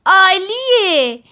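put-on high, loud child's cheer, drawn out; loud delighted laugh. A narrator's reading. Child hearing mother agree to a party, loudly delighted. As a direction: burst out in delight, stretching the word. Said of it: "آناک با صدای بلند خندید و گفت".

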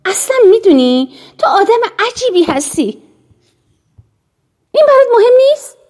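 rising high, loud and fast in anger; angry. A narrator's reading. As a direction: fling it at her, building to a shout. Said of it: "سوزی نیز با خشم گفت".

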